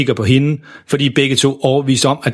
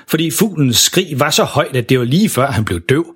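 plain reading voice

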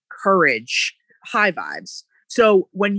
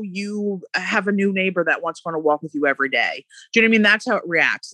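ordinary delivery

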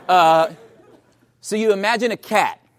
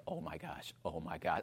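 barely voiced, much softer gasped words; frozen dread; 12 semitones lower, 20 dB quieter than usual. Husband frozen in bed, hushed terror. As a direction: breathe it out in terror.

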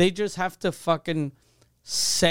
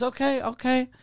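placating salesman; 9 semitones higher than usual. Retold or imitated. Acting the salesman placating him.

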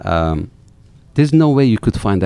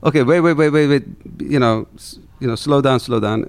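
higher, louder, hurried alarm; 7 semitones higher, 5 dB louder than usual. Retold or imitated. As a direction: rein him in, half panicked. Friend urging him to slow down.

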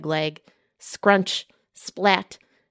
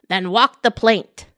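pirate threat; 7 dB louder than usual. Acted. Emma defies Miss Chew.

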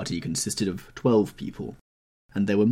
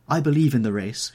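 louder, higher exclamation; crying out; 7 semitones higher, 7 dB louder than usual. A narrator's reading. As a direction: cry it out with conviction. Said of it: "she cried".

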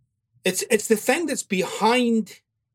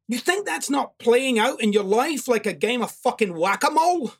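usual speaking voice

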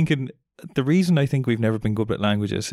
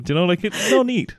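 ordinary talk, nothing marked

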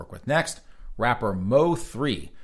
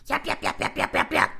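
rapid repeated yapping noise; mocking yap-yap chatter; imitated yapping. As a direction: mimic nonstop chatter as yaps. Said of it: "She just keeps talking".

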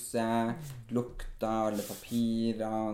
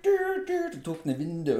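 mock angry scolding; 4 dB louder than usual.

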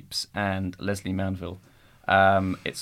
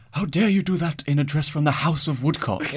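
posh film character; 6 semitones higher than usual. Retold or imitated. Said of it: "when they say things like".

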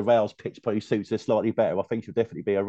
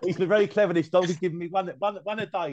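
laughing; 9 semitones higher, 4 dB louder than usual. Real, not acted. Joking the dog keeps agreeing.

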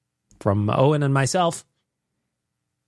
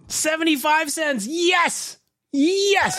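high, slow, rising to a shout; thrilled; 13 semitones higher, much slower than usual. Real, not acted. Host cheering the cheap drink price, rising to a shout. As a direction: savour the price, then shout yes.

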